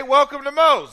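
loud, high store greeting; shouted greeting. Retold or imitated. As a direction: belt out the welcome. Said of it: "you gotta say".

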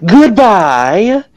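ordinary talk

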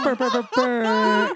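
loud slow air horn noise; air horn imitation.

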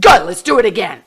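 loud, quick burst of eagerness; exhilarated shout. Retold or imitated. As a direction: shout it, thrilled and raring to go. Retells her exhilarated cry after jumping.

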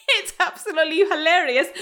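tickled, laughing as you talk. Real, not acted. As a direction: say it through real laughter. Laughing at her own laugh habit.

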